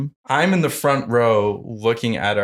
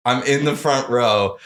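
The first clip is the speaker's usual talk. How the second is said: usual speaking voice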